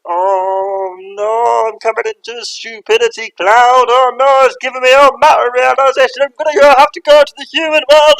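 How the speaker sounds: high, loud cartoon spirit squeal; panicking spirit. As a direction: squeal like a startled little spirit. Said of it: "and he goes".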